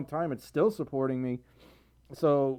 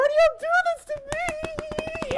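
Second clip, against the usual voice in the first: very high shrieked question; shocked shriek. Real, not acted. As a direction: screech it in disbelief. Shocked by big donation.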